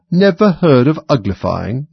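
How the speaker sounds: loud, high, drawn-out disbelief; surprised exclamation. A narrator's reading. Gryphon lifts its paws at Alice, exclaiming in surprise. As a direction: exclaim as if astonished by such ignorance. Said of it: "it exclaimed".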